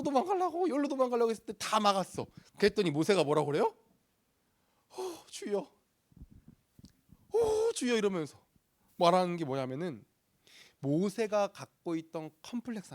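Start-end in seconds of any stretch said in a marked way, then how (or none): 5.0-8.0 mock lament. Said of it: "여러분, 모세 탄식 느껴져요?".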